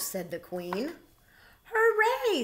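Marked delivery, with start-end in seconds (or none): 1.6-2.4 high, loud cheer; joyful hooray. A narrator's reading. Bear told his friend can join, high joyful cheer. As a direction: cheer with pure joy. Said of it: "said Bernard".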